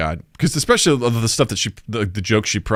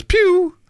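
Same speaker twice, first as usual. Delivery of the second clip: high-pitched sound-effect "pew"; mimicked slip of speech as noise; imitated pew noise. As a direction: squeak out a quick laser "pew". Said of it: "it came out as".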